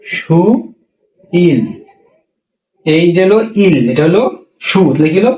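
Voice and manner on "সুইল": সুইল stretched very slowly, shu-iil; সুইল খুব টেনে ধীরে; drawn out demo. Shown as a demonstration. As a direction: hold সুইল long and slow. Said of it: "হ্যাঁ".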